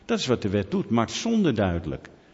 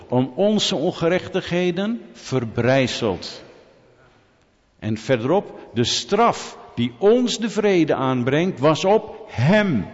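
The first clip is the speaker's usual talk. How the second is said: slow, emphatic scripture proclamation; drawn-out pulpit declamation; preaching; 4 semitones higher, 3 dB louder, slower than usual. Acted. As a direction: proclaim the verse slowly, hitting key words hard. Proclaims Bible verses to congregation.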